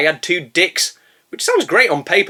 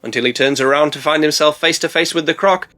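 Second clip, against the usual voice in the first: normal talking voice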